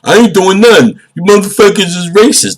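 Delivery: a hammed-up frantic arrestee; mock panicked arrestee. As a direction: play the guy yelling at the cops. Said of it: "Wasn't saying that like".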